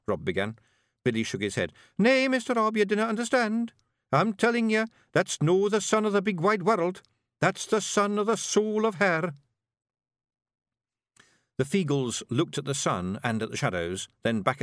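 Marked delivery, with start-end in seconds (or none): 2.0-9.3 high-pitched, insistent Scots laddie; high Scottish character voice, worried; urgent young Feegle bard. Acted. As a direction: plead to be understood as a wee novice. Billy urgently corrects Rob.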